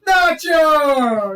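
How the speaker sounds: drawn-out shout, high and falling; whole-bar welcome yell; Cheers-style greeting. Acted. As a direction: hail a regular across the bar. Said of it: "it's like, "Cheers".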